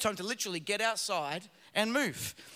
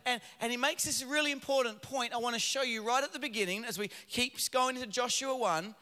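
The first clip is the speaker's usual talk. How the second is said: ordinary talk, nothing marked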